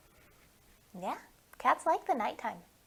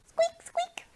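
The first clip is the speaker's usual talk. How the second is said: tiny squeak, much higher; mouse squeak; 13 semitones higher than usual. Acted. Imitating mouse again.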